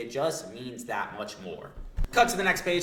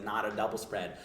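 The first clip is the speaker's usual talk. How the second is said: ordinary talk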